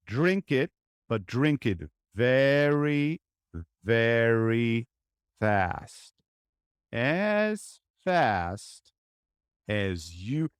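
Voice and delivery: drawn-out, slow and calm; very slow speech. Shown as a demonstration. Uncle soothing his niece with very slow words. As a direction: stretch every word out slowly. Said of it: "while I'm speaking really slowly".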